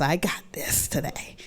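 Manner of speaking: hushed whisper, talking herself into it; whispered self pep talk. Retold or imitated. Alone in the bathroom, whispering encouragement to herself. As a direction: whisper it like a private promise to yourself.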